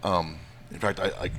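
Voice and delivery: normal talking voice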